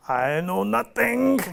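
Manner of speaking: lightly voiced Sergeant Schultz, slow German accent; slow, drawn-out German-accent "I know nothing". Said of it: "In his German accent".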